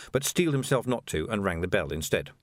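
plain reading voice

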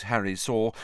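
plain reading voice

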